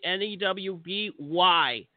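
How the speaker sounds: letter by letter, slow; spelled slowly. Real, not acted. Spelling out the name.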